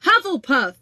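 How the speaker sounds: the Hat bellows the house name; shouted. A narrator's reading. Sorting Hat announces the house to the hall, shouting. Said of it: "shouted the Hat".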